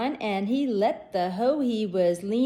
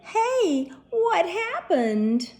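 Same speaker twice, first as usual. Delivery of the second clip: surprised; 9 semitones higher, 3 dB louder, much slower than usual. Acted. Dangling in the air.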